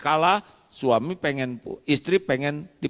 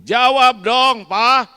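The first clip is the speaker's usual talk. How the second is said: higher, louder and slow, pressing for an answer; demanding call; 9 semitones higher, 8 dB louder, slower than usual. Acted. Wife to husband, demanding loudly. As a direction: insist he answer you.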